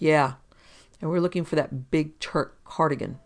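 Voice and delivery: plain reading voice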